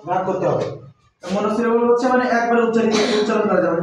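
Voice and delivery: usual speaking voice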